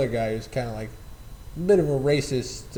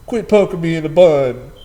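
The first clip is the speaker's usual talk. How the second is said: mock-indignant bird, louder, "Quit poking me in the bud" pushed out; exasperated fake bird voice, raised; louder annoyed bird; 3 semitones higher, 9 dB louder than usual. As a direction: ham up the irritated bird protesting. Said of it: "the attitude".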